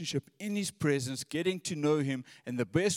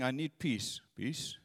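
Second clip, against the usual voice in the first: mock single-minded plea; 4 semitones lower, 7 dB quieter than usual. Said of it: "only listening for this one thing".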